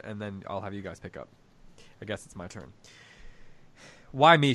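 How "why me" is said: loud, high rumbled protest; a loud hurt outburst at the heavens; rumbling cry. Acted. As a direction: throw the question up loud, aggrieved. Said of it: "she rumbled".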